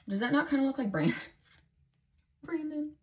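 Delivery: high excited squeal, laughing. Real, not acted. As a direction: squeal it out, delighted. Excited about a card resembling Brandon.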